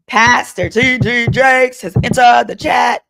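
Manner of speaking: loud, high singsong shout; mock announcement. Livestream host introduces the pastor to the chat, singsong mock-announcement shout. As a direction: holler it like a grand introduction, stretching the name.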